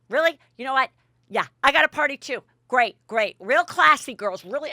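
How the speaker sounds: huffy, sarcastic retold retort; angry sarcasm, imitated. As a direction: act out scornful one-upping. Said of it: "is still angry as can be".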